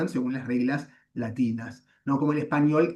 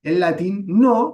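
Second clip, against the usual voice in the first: a slower, louder, rising no; emphatic no; 5 semitones higher, 6 dB louder, slower than usual. Shown as a demonstration. Teacher insisting Latin works differently, stressed no. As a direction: stress the no to mark the contrast. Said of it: "emphatic contrast".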